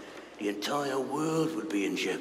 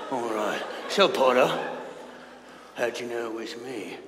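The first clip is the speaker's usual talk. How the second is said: normal talking voice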